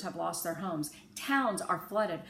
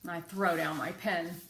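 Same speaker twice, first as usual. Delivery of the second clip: usual speaking voice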